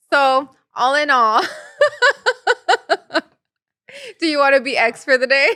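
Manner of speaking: amused, barely getting it out; laughing. Real, not acted. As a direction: break into giggles mid-question. Laughing over the lost phone.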